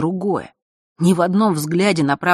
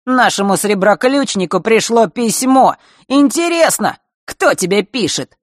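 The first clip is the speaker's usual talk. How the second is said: насмешливый задира; 6 semitones higher, 4 dB louder than usual. Acted. Марк дразнит Захару.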